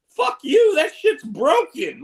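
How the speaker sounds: loud, high burst of outrage; indignant loud retort; angry outburst. Retold or imitated. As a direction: yell it at the doctors, outraged. Angry retort to doctors' claim.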